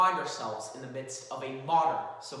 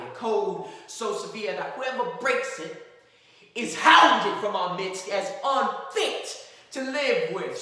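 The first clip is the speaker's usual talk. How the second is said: earnest, rising closing argument; courtroom lawyer; 7 semitones higher, 11 dB louder than usual. Acted. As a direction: plead with the jury, building. Atticus Finch addresses the jury.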